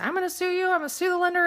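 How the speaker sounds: high-pitched imitation of an irate client; mock angry. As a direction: threaten like a worked-up client, lightly acted. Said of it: "I was just really mad".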